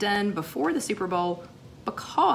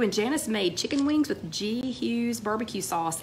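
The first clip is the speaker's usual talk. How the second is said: ordinary delivery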